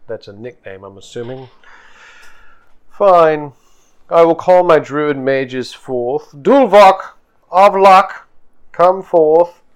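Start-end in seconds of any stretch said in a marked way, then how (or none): 2.9-9.6 loud, high call to her mages; summoning call. Acted. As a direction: call them forth with authority. Chieftainess summons her druid mages.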